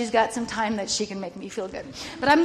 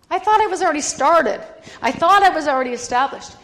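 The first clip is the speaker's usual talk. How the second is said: loud, high protest of disbelief; exasperated outburst; 6 semitones higher, 12 dB louder than usual. Real, not acted. Protesting God's answer, loud and high. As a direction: burst out, indignant.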